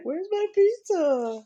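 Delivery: high, slow, whiny puppy voice; dog's voice. Pretend. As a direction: voice the dog searching for pizza. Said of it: "She's like".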